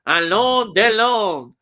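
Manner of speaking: mock French, drawn-out vowels; attempted French accent. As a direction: have a bad go at a French accent. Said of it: "I just can't do the French accent".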